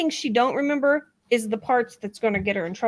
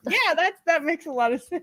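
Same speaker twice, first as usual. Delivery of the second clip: sarcastic agreement; 6 semitones higher than usual. Retold or imitated. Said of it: "It was just like".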